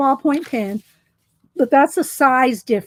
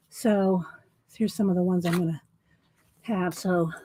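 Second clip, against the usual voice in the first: breathless after running; winded from the stairs; out of breath; 4 semitones lower, 5 dB quieter than usual. Real, not acted. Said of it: "I'm out of breath".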